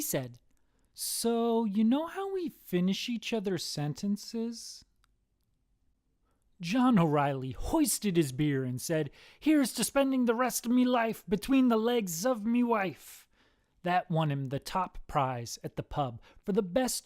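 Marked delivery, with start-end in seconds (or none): 0.9-4.8 higher-pitched leading question; twin brother. Acted. Brother calls from prison, higher hinting voice. 9.4-13.3 high, hearty Irish toast; toasting Irishman. Acted. Man hoists his beer, hearty Irish lilt.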